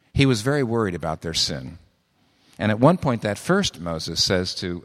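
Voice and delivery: normal talking voice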